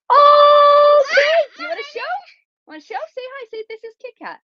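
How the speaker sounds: high sing-song coaxing. Real, not acted. Coaxing daughter to show cat.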